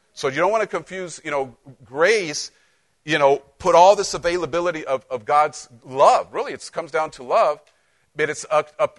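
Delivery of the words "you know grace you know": normal talking voice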